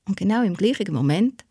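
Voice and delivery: plain reading voice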